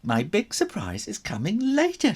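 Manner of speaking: high, bright excited aunt; excited auntie voice; excitedly. A narrator's reading. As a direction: tease the surprise eagerly. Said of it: "she said excitedly".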